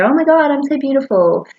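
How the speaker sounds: a mocking, preening admiration of yourself; vain mirror gush. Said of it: "I don't know why I said that like that".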